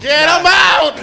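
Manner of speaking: high, hammed-up yell; very loud, high joke order; mock angry shout. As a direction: shout it like a parody heckler. Joins in the mock racist routine.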